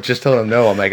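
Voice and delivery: ordinary talk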